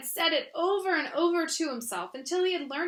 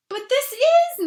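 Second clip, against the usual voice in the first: higher, louder and slow, surprised; 12 semitones higher, 10 dB louder, slower than usual. Acted. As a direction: protest in amazement. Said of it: "very much surprised".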